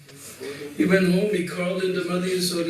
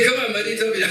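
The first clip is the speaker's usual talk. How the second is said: put-on pleading child; 7 semitones higher, much faster than usual. Krishna pleading with the boys.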